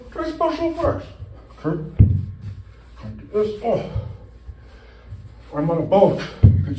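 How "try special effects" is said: squeaky ad voice. Pretend. Pitching special effects to viewers in a high ad voice. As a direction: jump way up high for a cheesy ad tagline. Mock ad tagline.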